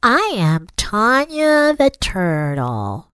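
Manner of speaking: high, very slow turtle voice. A narrator's reading. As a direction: drag each word out slowly. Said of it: "the turtle said very slowly".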